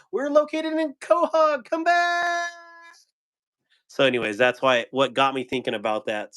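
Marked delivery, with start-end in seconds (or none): 0.1-2.5 high, hammed-up salesman pitch; car salesman impression. Quoting the Family Guy car lot ad, in a high hammy salesman voice. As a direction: squeal it out like a cheesy TV ad. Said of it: "I was like".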